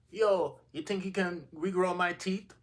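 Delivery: high, loud imitation of a Jamaican woman; Jamaican lady. Imitating a Jamaican lady asking the dude for help, accented and high. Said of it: "some Jamaican lady was like".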